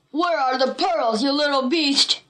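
restrained villain's shout; angry shout. A narrator's reading. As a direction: demand angrily in a put-on bully voice. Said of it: "shouted Buck".

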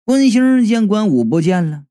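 plain reading voice

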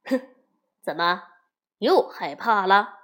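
a touch of a sneering taunt; mildly scoffing at his fear; scornful. A narrator's reading. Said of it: "高个子轻蔑地说". (略带轻蔑地嘲讽)

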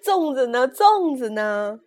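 loud, drawn-out comic wail; plaintive shout, stretched out; mock tearful wail. A narrator's reading. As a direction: cry out slowly in hammed-up despair. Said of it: "哭着喊".

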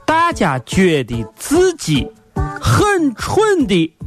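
high, very slow teasing delivery; very slow drawl. Acted. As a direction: drag every word out slowly. Slow teasing test question. (很慢很拖的调侃语气)

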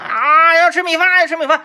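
high-pitched mock kid tantrum, rising as it goes; mock whining child. Retells own childhood tantrum demanding rice. (模仿小孩撒娇闹着要吃饭)

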